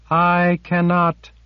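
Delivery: eerie, high and slow; the silver creature refuses, slowly; slow high sliff refusal. A narrator's reading. Sliff answers Kara, slow and high.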